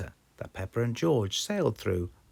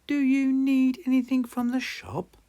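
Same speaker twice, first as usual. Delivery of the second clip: much higher, louder granny offering help; a sweet cartoon granny pitched way up; Granny Pig voice; 7 dB louder than usual. A narrator's reading. Granny Pig calling from the boat, much higher. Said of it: "Granny Pig asked".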